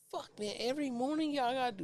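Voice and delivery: high-pitched exasperated whine. Retold or imitated. Grumbling at neighbours every morning, exasperated. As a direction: complain in a strained high voice, re-enacted.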